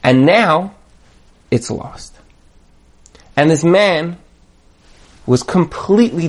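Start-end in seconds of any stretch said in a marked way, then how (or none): 1.5-2.1 hushed whisper. Acted. Mournful end of the parable.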